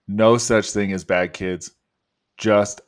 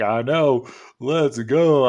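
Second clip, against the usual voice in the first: louder, higher retold hype; excited; 5 semitones higher, 4 dB louder, slower than usual. Husband answering pregnant wife, excited and louder. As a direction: replay the rush of hearing the news. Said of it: "I was so excited".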